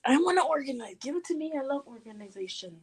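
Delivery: soft, high eager plea; playful begging. Pretend. Friend wants to organize the junk, playful begging. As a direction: beg quietly in a small, high voice.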